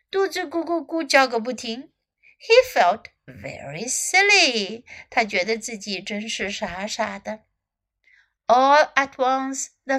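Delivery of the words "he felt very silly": ordinary delivery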